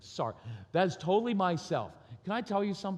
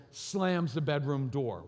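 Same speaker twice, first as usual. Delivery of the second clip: usual speaking voice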